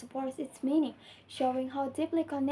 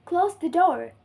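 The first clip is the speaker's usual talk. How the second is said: loud, high "Close", falling to "door"; firm command dropping on "door"; falling intonation; 4 semitones higher, 8 dB louder than usual. Shown as a demonstration. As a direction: start "Close" high, let "door" fall. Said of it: "pitch of voice drops at the end".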